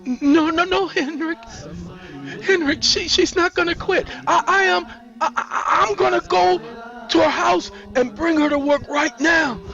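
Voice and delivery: high-pitched stammering panic; frightened. A narrator's reading. Sandra pleading as Henrik holds a gun, frightened. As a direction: beg loudly, tripping over the words. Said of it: "Sandra is frightened".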